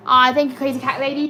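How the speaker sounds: normal talking voice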